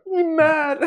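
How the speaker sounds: very high, loud put-on "you mad?"; incredulous. Answering Jackie's thanks, very high loud incredulous voice. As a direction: ask in mock disbelief.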